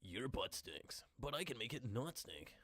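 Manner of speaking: oily infomercial pitch; smarmy ad voice. Shown as a demonstration. Mock ad pitch.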